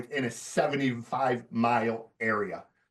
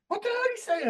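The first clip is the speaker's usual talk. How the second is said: very high, squeaky mock disbelief; hammed-up shocked squeal; shocked Jacob; faster than usual. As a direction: imitate the buyer's stunned reaction. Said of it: "Jacob's like".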